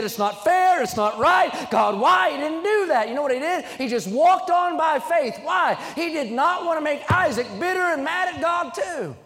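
high, loud preacher at full force, building; climbing, shouting sermon peak; fiery preaching. Acted. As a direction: preach it out to the whole room.

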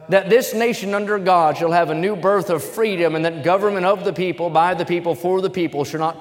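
stirring speech recitation; oratory reading. Acted. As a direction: proclaim it like a formal address. Reads Gettysburg Address aloud.

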